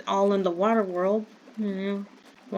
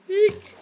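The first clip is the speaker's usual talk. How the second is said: a sudden, high little shriek; high startled squeak; 12 semitones higher, 4 dB louder than usual. Real, not acted. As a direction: squeak it out in fright. Startled by gunfire in game.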